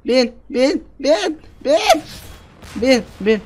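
willing the ride to go; frantic urging. Real, not acted. Gamer frantically urges himself to climb aboard.

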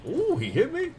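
high, loud yelp of surprise; surprised yelp. Real, not acted. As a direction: yelp as you get hit. Zombie hits him.

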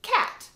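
"cat" said as one high, loud clapped beat; one sharp, high "cat" for clapping; clapped syllable. Shown as a demonstration. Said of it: "you clap out cat".

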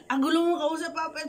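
high, loud mock voice of a child teasing Papa. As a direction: play the cheeky kid scolding Papa. Listener teases the speaker. (mataas at malakas na boses ng batang nanunukso)

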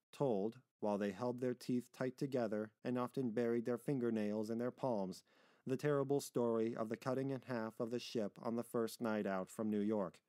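ordinary delivery, nothing marked

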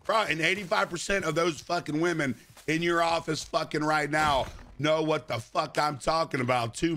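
ordinary talk

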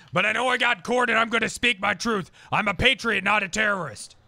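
emphatic, hammed-up self-declared patriot; punchy mock-righteous protest; indignant conspiracy crank. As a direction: plead your case like an outraged defendant. Defendant character argues his case.

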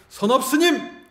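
high, loud, long shout; forceful name call. Shown as a demonstration. Monk showing how he would call his own name, forceful shout. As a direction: belt out the name with full force. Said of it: "이렇게 딱 불러가지고".

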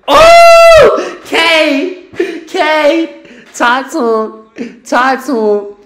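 very high, loud hype yelling; excited shout. Real, not acted. Cheering Kevin's big play on TV, loud high shout. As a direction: scream with joy at the play.

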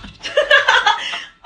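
sudden high, loud cackle; burst of laughter. Real, not acted. Laughs after losing her train.

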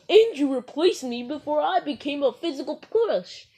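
ordinary talk

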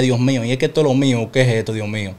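understated retold crying to God; retold despair. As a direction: quietly plead to the sky in despair. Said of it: "Y lloraba".

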